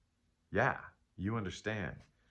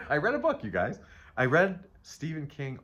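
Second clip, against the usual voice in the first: excited; 7 semitones higher, 7 dB louder than usual. Real, not acted. Proudly announcing she read a book.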